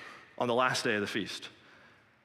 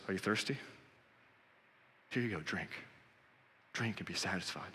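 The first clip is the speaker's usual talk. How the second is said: soft, lower, tender invitation; almost hushed, handing over a cup; gentle offer; 5 semitones lower, 10 dB quieter than usual. Acted. Jesus gently offering living water.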